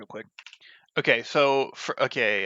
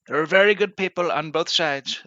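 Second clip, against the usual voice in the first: higher, louder put-on politician voice; Trump impression; 6 semitones higher, 6 dB louder than usual. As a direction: nail one famous line in character. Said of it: "for the Trump impression".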